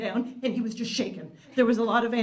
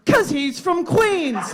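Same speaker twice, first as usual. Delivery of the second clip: mock chorus shout; 8 semitones higher, 6 dB louder, slower than usual. Both men chorus punchline.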